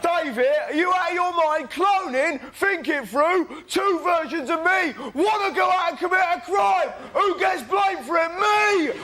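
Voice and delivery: high, loud, mounting outrage; outraged rant. Acted. Arguing with David about cloning, outraged rant. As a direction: shout in disbelief, climbing to the last word.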